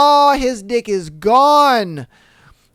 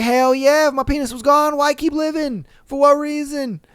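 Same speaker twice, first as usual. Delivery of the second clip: higher, hammed-up bro cheer; mock agreeing guys; 6 semitones higher than usual. Voices the listening guys.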